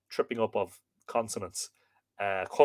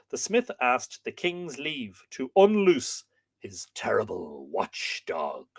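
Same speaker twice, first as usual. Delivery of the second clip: story narration; 5 semitones higher, 6 dB louder than usual. A narrator's reading. Storyteller introducing the smith's watchdog, ominous slowing narration. Reads a myth aloud.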